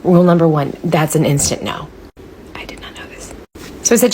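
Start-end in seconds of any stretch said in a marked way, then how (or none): 2.5-3.3 hushed, breathy storytelling; whispered confession to viewers; whispered aside. Acted. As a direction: let them in on a secret.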